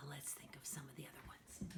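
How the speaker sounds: whisper. Real, not acted. Aside while thinking of titles.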